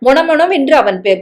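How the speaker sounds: usual speaking voice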